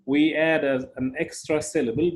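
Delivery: ordinary talk, nothing marked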